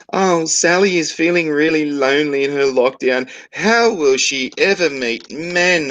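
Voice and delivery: high put-on gushing voice, slow at the end; mock news sob story. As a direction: parody a soppy news report.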